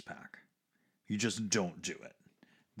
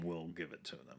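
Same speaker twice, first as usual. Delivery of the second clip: quiet breathy vow; whispering; 9 dB quieter, faster than usual. Real, not acted. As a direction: say it under your breath.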